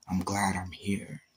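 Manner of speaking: breathy, whispered, soft relief; relieved whisper. Retold or imitated. Imagining his future self, a relieved whisper. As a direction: whisper it like a quiet thank-you.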